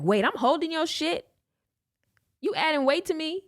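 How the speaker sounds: high-pitched incredulous question; indignant disbelief. Retold or imitated. Man stuck holding her stuff, indignant disbelief. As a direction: retell it affronted, pitch shooting up. His indignant reaction in his head.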